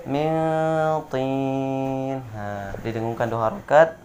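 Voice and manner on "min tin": min tin with the n hummed long; correct nasal hold. Shown as a demonstration. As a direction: hum the n before tin. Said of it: "Sekali lagi yang betul". (mintin dengan dengung dua harokat)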